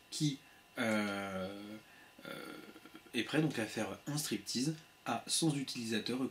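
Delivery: slow, deliberate, drawn-out delivery. Shown as a demonstration. Streamer slowing down for a viewer who said he talked too fast, slow delivery. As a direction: spell every word out slowly for the viewer. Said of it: "je vais essayer de parler plus lentement".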